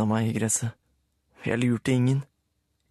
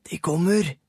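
Rulle alarmed; 7 semitones higher than usual. A narrator's reading. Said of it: "sa Rulle".

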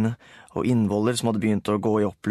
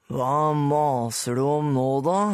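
Rulle annoyed; 4 semitones higher, slower than usual. A narrator's reading. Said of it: "svarte Rulle".

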